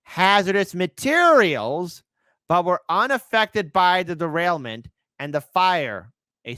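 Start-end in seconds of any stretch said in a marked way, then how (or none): 0.0-2.0 slow, heavy stress on "hazardous materials"; "hazardous materials" stretched out for the listener to catch; pointed emphasis. Real, not acted. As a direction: land hard on "hazardous materials", drawing it out. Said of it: "listen to me very carefully".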